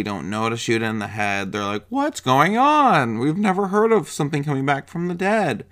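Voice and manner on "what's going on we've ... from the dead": mock clueless panic. Characters seeing their first zombie, mock bewildered. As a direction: ham up total naive confusion.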